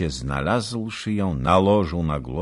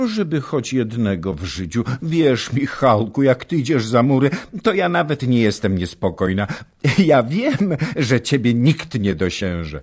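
higher, louder and quick; breathless adoring chatter; excited young wife; 8 semitones higher, 5 dB louder than usual. A narrator's reading. Baśka reassuring herself aloud to her husband, excited and high.

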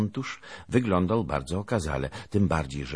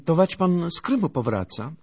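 put-on high, louder girlish question; inquisitive girl; 13 semitones higher, 6 dB louder than usual. A narrator's reading. Anusia stops the lieutenant to ask, inquisitive. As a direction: ask eagerly in a high girl's voice. Girl asks about his journey.

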